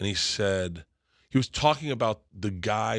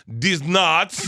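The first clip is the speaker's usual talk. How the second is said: put-on Croatian accent; 6 semitones higher, 7 dB louder than usual. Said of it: "Eva, who's from Croatia".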